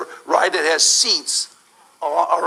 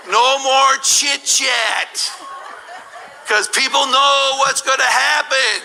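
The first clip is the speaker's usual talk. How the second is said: tense build-up; 6 semitones higher, slower than usual. Acted. Dramatic suspense at top.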